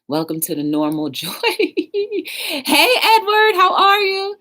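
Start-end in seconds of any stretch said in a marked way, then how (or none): 1.6-4.4 high, loud happy hello; excited greeting. Real, not acted. Streamer greeting Edward as they join, excited high call. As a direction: call out a delighted welcome. Greets arriving viewer by name.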